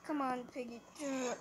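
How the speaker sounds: usual speaking voice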